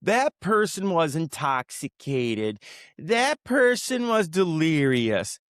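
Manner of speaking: sneering impression of management brushing it off; mocking management excuses. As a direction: drag out the excuses like a bored administrator. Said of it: "It's always".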